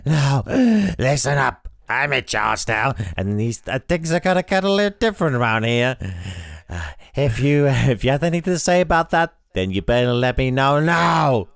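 a hammed-up creepy, husky villainess. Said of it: "in a creepy, husky voice".

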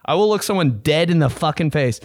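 ordinary talk

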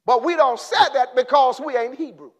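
ordinary delivery

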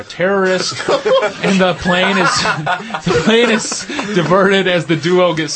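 laughing while reading. Real, not acted. Host reading a silly film synopsis to co-hosts, laughing. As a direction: read on through real giggles.

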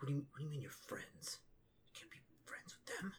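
soft, whispery incredulous question; half-whispered, disbelieving orc; hushed disbelief. Acted. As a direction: question it under your breath, rising at the end.

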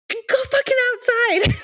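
loud, high-pitched exasperated shout; high, hammy shout; retold yell. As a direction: yell it like you're ordering kids out. Yelling at the kids, retold.

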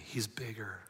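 soft whisper; hushed affirmation. Real, not acted. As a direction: breathe it quietly to them. Quiet sermon reflection.

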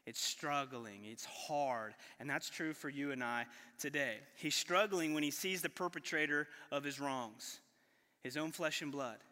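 ordinary talk, nothing marked

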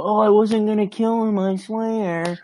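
high-pitched mock excuse-making; spineless pleading. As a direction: imitate a coward protesting innocence to save himself. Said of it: "he seems almost spineless".

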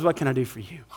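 low, soft, selfless question; gentle offer. Acted. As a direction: offer it quietly and tenderly. Love's selfless question, against lust's.

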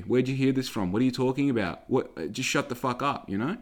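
ordinary delivery

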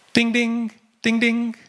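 high, ringing imitated phone alert; ding like an incoming text, twice over; bright mimicked notification, pitched high; text message chime. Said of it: "My text message arrives".

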